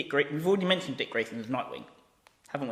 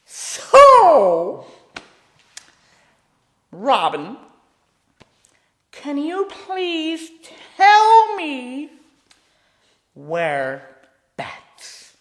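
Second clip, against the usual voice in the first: high, loud, theatrical sing-song villain croon; Joker voice; 15 dB louder, much slower than usual. Acted. Joker sweetly coaxing tied-up Robin to give up Bats. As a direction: wheedle it out of him like a grinning madman, way up high. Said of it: "he's kind of like".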